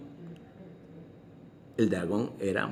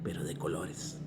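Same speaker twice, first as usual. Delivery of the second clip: whisper; 2 semitones lower, 6 dB quieter, slower than usual. A narrator's reading. Whispered end of shrinking.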